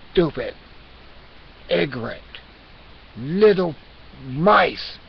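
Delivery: loud, word-by-word scolding; hammed-up cat contempt; scornful insult.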